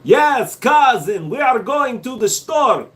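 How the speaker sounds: loud, high mock Russian; Russian accent. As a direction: call out as a hammed-up Russian cousin. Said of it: "I'm done with the Russian".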